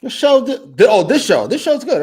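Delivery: high loud panicky backtrack; flustered. Real, not acted. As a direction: scramble to take it back. Flustered backpedal.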